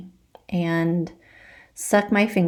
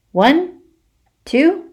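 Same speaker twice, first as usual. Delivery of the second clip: rising higher and louder count; magic countdown; 10 semitones higher, 5 dB louder, much slower than usual. Acted. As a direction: count down to the spell with glee.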